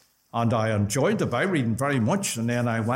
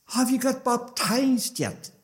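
higher, slow southern Irish brogue; imitated southern Irish brogue, pitched up; 9 semitones higher than usual. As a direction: put the question in a drawn-out southern Irish lilt. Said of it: "he never lost his southern brogue".

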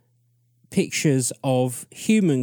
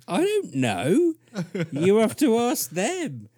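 exasperated abductee; 9 semitones higher than usual. Retold or imitated. Abductee tells the aliens to ask others, mock-baffled higher voice. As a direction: play the stumped man who's had enough of questions. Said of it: "And he's like".

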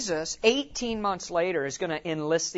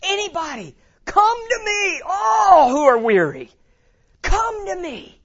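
Jesus calling out; 12 semitones higher, 7 dB louder than usual. Retold or imitated. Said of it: "Jesus throws that net wide".

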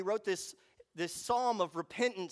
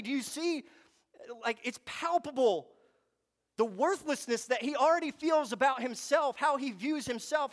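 ordinary talk, nothing marked

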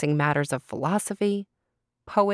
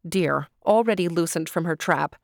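ordinary delivery

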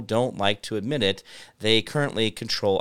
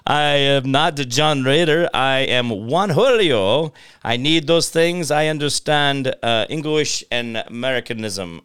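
put-on Spanish accent; 5 dB louder than usual. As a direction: speak slowly in a Spanish accent. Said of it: "we're calling you now Juan Julio".

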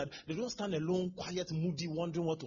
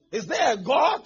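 shouted, higher, rising at the end; despairing shout; 7 semitones higher, 14 dB louder, slower than usual. Retold or imitated. Imitating a despairing believer shouting at the crowd. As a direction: cry it out louder the second time.

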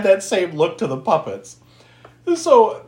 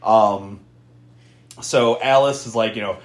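ordinary talk

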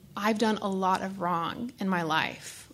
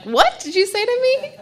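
high, loud mock offence; mock shock; 13 semitones higher, 9 dB louder than usual. To her sponsor, mock shocked squeal. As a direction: pretend you misheard something rude. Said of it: "And I'm like".

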